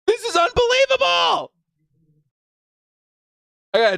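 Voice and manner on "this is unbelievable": much higher, shouted disbelief; ecstatic shout. Real, not acted. Fan stunned by the pick, shrieking with joy. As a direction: yell it at the top of your range. Reacting live to a draft pick.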